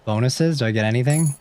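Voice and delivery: ordinary talk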